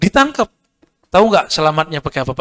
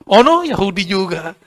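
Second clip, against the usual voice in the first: mock alarmed remark; 2 semitones higher than usual. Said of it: "Berarti samping saya Yahudi nih".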